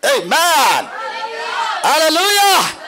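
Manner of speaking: joyful church shout; exclaimed hallelujah. Real, not acted. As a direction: call out to the congregation. Preacher calls praise.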